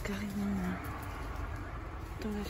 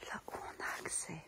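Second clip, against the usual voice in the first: hushed whisper, barely voiced; library whisper; 5 dB quieter than usual. Real, not acted. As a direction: whisper so nobody hears you. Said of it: "Je parle tout bas". (chuchotement discret)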